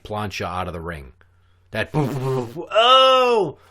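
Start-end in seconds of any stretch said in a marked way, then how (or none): 1.9-3.6 thudding booms into a high, loud, drawn-out wail; mock tumble with a long high "oh"; imitated crash and cry. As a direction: mimic bouncing off a car, then howling. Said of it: "I heard that in the car".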